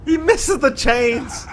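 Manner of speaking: high, slow stretched disbelief; drawn-out excited call. Real, not acted. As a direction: stretch it out in amazement. Caster repeats the miss drawn out.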